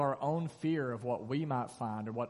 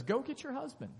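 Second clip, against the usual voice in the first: much higher, a pointed abrupt order; blunt demand; 12 semitones higher than usual. Retold or imitated. Preacher mocking Jesus bluntly ordering her to fetch her husband, high and abrupt. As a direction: fire it off like a jerk move. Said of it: "Jesus says".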